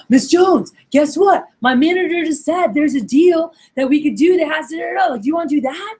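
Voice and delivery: high, excited sales pitch; imitated rep bubbling over with excitement; fired-up rep. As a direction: burst in with big news for the customer. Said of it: "your rep gets fired up".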